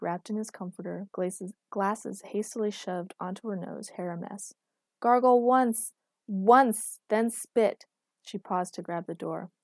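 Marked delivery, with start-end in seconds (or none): 5.0-7.9 loud, irritated order, pitched up; annoyed. A narrator's reading. Girlfriend orders him how to gargle, annoyed. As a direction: lay down the rule crossly. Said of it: "An annoyed Erica appeared".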